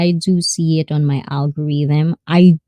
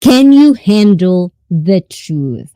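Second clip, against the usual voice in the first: slow, loud movie-style challenge; dramatic challenge; 5 dB louder, slower than usual. Pretend. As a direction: throw it down slowly and theatrically to the audience. Echoes famous movie line to audience.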